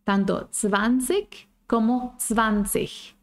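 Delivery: "zwanzig" first with k ending then with ch ending, slowly; two pronunciations. Shown as a demonstration. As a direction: contrast "zwanzig" k versus ch, drawn out. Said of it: "Depende de las zonas".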